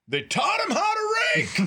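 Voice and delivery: dad yelling a joke to the zoo; yelled joke. Retold or imitated. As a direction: shout it across the zoo. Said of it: "and I yell".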